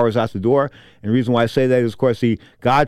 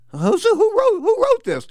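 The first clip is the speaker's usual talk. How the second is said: mock disbelief; slower than usual. Mock outrage at quote.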